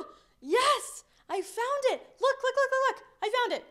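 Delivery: high, loud, thrilled shout; excited shout. Pretend. Child calling to mom after finding a lost toy, overjoyed. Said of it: "you go".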